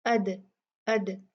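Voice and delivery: अद with a bouncing echo on the द; अद recited with qalqala; qalqala on dal. Shown as a demonstration. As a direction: let the द of अद bounce lightly. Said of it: "इसको हिला के पढ़ा".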